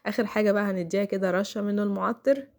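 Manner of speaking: usual speaking voice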